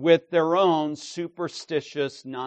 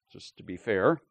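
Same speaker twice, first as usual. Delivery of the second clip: ordinary talk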